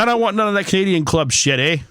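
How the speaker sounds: play-acting a Canadian bar customer, eh; Canadian accent. Friend retelling a Canadian's bar order, mock Canadian accent. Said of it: "he didn't say it at the end of it... add that in there for effect".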